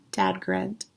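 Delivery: ordinary delivery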